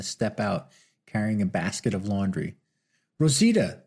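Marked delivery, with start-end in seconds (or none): 3.2-3.8 calling her name, high and loud; cried out. Acted. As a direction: call out across the street to her. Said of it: "Martin cried".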